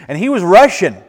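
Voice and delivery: usual speaking voice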